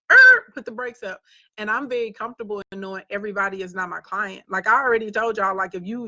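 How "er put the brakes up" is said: loud, higher sharp halt; firm stop. Pretend. To pushy people, a loud firm stop. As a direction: shout it like slamming the brakes. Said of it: "you gotta be like".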